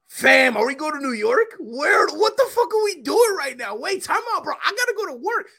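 high, frantic rising panic, mostly retold; panicked freak-out. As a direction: voice climbing in mock terror as the highway rushes by. Freaking out on the highway.

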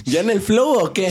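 teasing impression of his hello; imitating his greeting. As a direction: copy his catchphrase playfully. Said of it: "su típico saludito".

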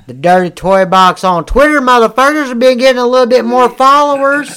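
loud, high, excited plug; hyped-up shout. Real, not acted. Podcaster plugging the show's Twitter, hyped-up shout. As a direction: holler it like a hype man. Hyping up their Twitter account.

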